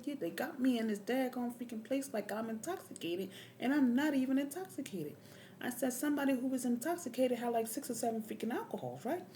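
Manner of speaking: high-pitched, heated rant; indignant protest. Retold or imitated. Patient protests intoxication arrest to staff, indignant high voice. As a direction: argue the case in loud exasperation.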